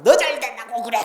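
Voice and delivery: loud scolding parent, a touch mocking; nagging parent. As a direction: play the stressed parent venting on the child. Nagging parent voiced in sermon.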